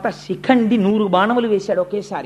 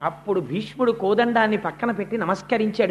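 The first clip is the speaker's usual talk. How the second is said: ordinary talk, nothing marked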